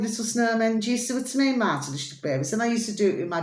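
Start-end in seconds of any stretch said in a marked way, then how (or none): none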